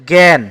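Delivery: "জ্ঞান" firmly as gæn; open e pronunciation. Shown as a demonstration. Teacher gives the pronunciation of the answer, "জ্ঞান" as gæn. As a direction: say "জ্ঞান" with æ, not aa. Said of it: "এটা উচ্চারণ হচ্ছে".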